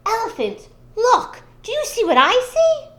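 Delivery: high, loud, eager call; excited trickster. Acted. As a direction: point it out with sly excitement. Anansi springs his trick.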